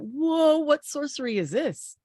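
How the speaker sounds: high, loud startled whoa; startled cry. Acted. Gawain jumps back from the maiden, high and loud in shock.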